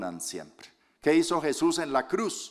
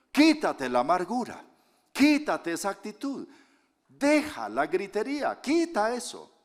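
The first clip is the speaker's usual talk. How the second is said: 1 scolding; 8 semitones higher than usual. Real, not acted. Preacher scolding the shouting.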